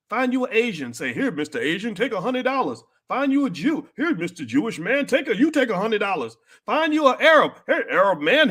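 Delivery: a hint of a sing-song mock voice handing out money; mocking influencer. Mocking rappers telling fans to give money away, lightly voiced. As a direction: parody the rappers' advice, lightly.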